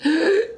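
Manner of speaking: sharp high gasp for air; dying gasp. Acted. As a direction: gasp like a last breath. Dying ant's last breath.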